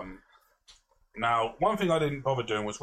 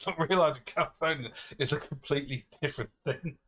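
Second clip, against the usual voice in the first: ordinary talk, nothing marked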